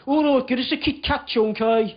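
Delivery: imitated Birmingham flatmate; Birmingham accent. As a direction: give in with broad Birmingham vowels. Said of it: "in his Birmingham accent".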